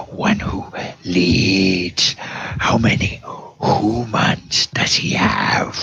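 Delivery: demanding answers in a menacing whisper; whispery gnoll. Acted. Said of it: "leader says".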